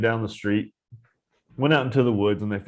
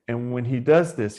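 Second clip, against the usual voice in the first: ordinary delivery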